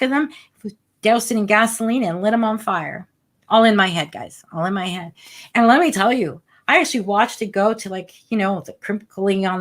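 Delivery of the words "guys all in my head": quiet repeat. Real, not acted. Host quietly repeating it to listeners. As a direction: drop much softer on the repeat.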